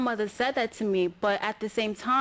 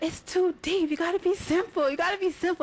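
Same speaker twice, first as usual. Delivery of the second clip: a higher, lightly voiced mother laying down the law; lecturing mom; 8 semitones higher than usual. Mom telling her daughter to keep poems simple, lightly voiced. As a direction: play mom setting her straight.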